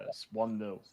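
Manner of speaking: ordinary delivery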